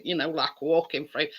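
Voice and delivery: normal talking voice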